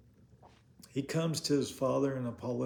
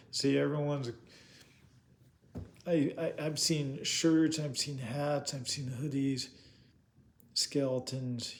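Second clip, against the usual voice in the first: normal talking voice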